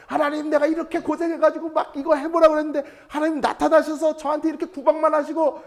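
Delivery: put-on high, loud, childish whine at God; whining Job. Imagined Job sulking at God, high childish whine. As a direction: sulk and complain like a spoiled child. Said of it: "막 이럴 줄 알았거든요".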